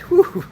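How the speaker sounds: high breathy whew of being out of breath; puffing after the walk; winded whew. Real, not acted. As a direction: blow out like you're winded. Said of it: "I'm kinda winded".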